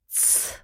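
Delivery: soft voiceless "tss"; hushing shush. A narrator's reading. Witch silences the heroine, soft hushing shush. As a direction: hush them with a hissed shush. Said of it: "она приложила палец к губам".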